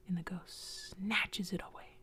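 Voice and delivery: ordinary delivery, nothing marked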